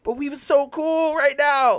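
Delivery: high, slow whining protest; whiny fan. Retold or imitated. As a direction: whine like a kid losing a toy. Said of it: "And I'm just like".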